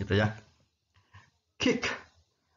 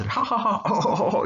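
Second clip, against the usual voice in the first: demo laugh; 7 semitones higher than usual. Shown as a demonstration. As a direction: act out a big laugh. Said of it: "gitu ya".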